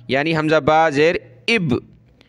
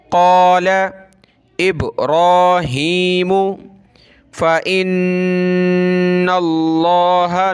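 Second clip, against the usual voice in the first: Quran recitation; 2 semitones higher, much slower than usual. Shown as a demonstration. Teacher recites the verse slowly for pupils. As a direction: intone it slowly like tilawat.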